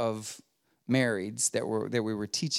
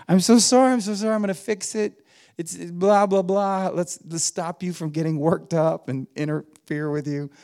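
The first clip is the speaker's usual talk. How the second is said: frantic apology; 5 semitones higher than usual. Retold or imitated. Pastor imitating himself cutting in on an angry caller, rushed and high. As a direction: gush sorry over her to cut her off. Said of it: "to interrupt her and go".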